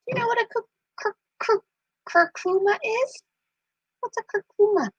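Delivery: squeaky soft question to a parrot; high pet-talk question. Real, not acted. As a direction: ask the bird sweetly up high.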